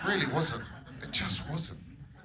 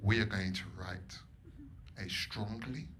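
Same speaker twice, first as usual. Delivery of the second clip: lower, soft and slow, prim posh friend; 8 semitones lower, 9 dB quieter, slower than usual. Retold or imitated. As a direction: spell out each word like a prim friend. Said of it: "And Lily said".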